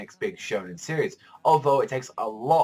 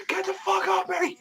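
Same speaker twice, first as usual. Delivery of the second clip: high-pitched mock shout, much higher; mock yell; 4 dB louder than usual. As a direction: squeal out a retold yell at the rival. Asta's rival yelling at him.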